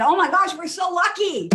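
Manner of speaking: high, loud gushing delight; bubbly and thrilled, blurting it out; cheery exclamation. Retold or imitated. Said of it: "I said".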